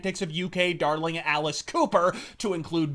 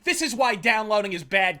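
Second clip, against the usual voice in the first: usual speaking voice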